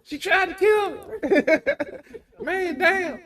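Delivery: high, loud squeals broken by real laughter; laughing shriek. Real, not acted. Host shrieking through laughter as his co-host goes after him. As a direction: yelp as if you're under attack, cracking up.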